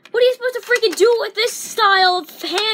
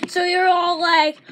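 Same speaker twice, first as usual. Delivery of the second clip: ordinary talk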